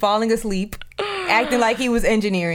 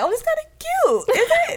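very high, laughing mock voice; imitating a buyer fooled by the model; mock fooled shopper. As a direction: squeak it out while laughing. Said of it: "You be like".

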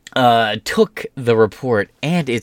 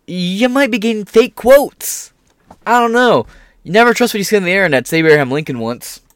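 high, loud hammed-up wisdom; mock sage; 9 semitones higher, 5 dB louder than usual. Lecturing the reporter with mock wisdom. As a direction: deliver it like a fake proverb.

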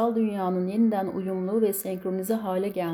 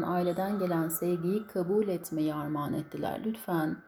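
normal talking voice

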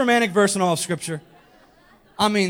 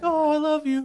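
put-on higher, swooning girl voice; smitten woman; 7 semitones higher than usual. Girl answering her swaggering suitor, mock swooning. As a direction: melt in a high, lovestruck voice. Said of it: "And she's like".

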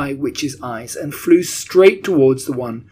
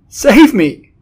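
high, loud cry for help; desperate, piercing plea; cried; 10 semitones higher, 13 dB louder than usual. A narrator's reading. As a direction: shriek in terror for rescue. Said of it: "cried the wretched witch".

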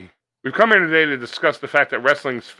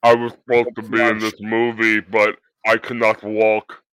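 lumber through it like a huge wrestler; Andre the Giant; 2 semitones lower than usual. Pretend. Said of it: "It's called Andre the Giant".

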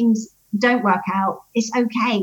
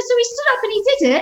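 put-on excited child; 12 semitones higher, faster than usual. Son voiced excited.

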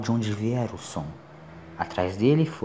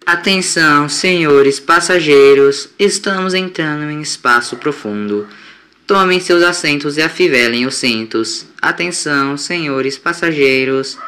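robotic announcement voice; 3 semitones higher, 7 dB louder than usual. A narrator's reading. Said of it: "uma voz roboticamente neutra dizia".

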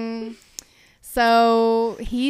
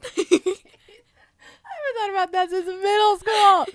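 laughing; 7 semitones higher than usual. Real, not acted. Laughing at star sixty-seven.

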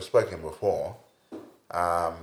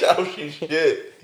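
mock London accent; 6 semitones higher, much slower than usual. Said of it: "I'm from Chelsea one day".

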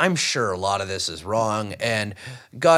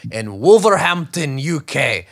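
bad Scottish accent; slow, loud attempt at Scottish accent; 2 semitones higher, 5 dB louder, slower than usual. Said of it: "that was a terrible Scottish accent".

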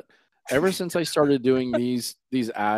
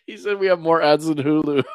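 laughing; 6 semitones higher, 4 dB louder than usual. Real, not acted. Repeats the joke while laughing.

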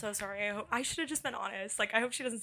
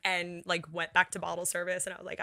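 usual speaking voice

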